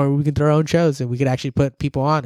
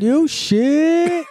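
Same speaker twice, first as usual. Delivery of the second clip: excited; 11 semitones higher than usual. Retold or imitated. Echoing the quoted phrase, loudly.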